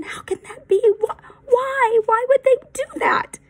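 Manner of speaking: high, rising, stammering disbelief; can't believe what he hears; shocked disbelief. Acted. Benjamin asks Eli why, high and shocked.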